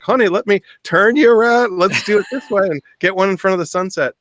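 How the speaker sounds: high, loud, sing-song coaxing; sweetly coaxing. Retold or imitated. Groom turning his fiancée toward the sunset, sugary coaxing. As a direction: steer her around with forced sweetness. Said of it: "And I'm like".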